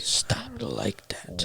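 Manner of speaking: hushed whisper. Retold or imitated. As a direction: whisper it, slow and amazed. Retold quote, unvoiced whisper.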